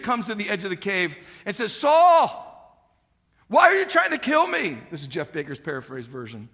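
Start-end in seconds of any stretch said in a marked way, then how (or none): 1.7-4.8 high, loud call across a valley. Retold or imitated. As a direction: shout it to someone far off.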